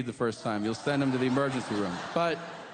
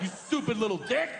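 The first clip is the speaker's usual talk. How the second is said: scolding; 7 semitones higher than usual. Acted. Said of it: "And you're like".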